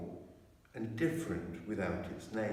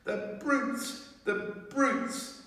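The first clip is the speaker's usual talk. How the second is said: much higher, tearful cry; tearful outrage; 13 semitones higher, much slower than usual. A narrator's reading. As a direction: cry out in heartbroken anger. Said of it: "tears streaming down her cheeks".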